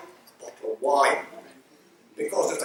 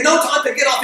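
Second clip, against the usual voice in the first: laughing; 7 semitones higher, 4 dB louder than usual. Real, not acted. Cycling into police car.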